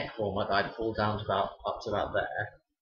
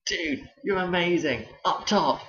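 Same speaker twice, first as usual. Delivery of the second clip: much higher and louder, slowed mock drunk lad; drunk pupil; 9 semitones higher, 5 dB louder, slower than usual. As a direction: imitate a drunk kid asking for a high five. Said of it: "I was like".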